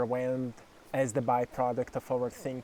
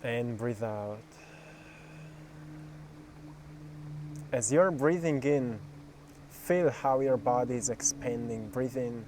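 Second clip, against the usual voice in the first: guided meditation; 3 dB quieter than usual. Acted. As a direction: guide them gently through each breath.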